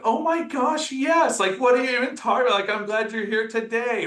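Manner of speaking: high-pitched excited reassurance; friend's surprised reassurance. Retold or imitated. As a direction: gush like a surprised friend brushing it off. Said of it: "And she was like".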